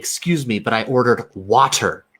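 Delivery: "water" stressed with a crisp, released T; a complaint landing hard on "water"; emphatic hard T. Acted. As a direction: insist on "water" as "WAH-TER". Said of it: "if you were trying to emphasize".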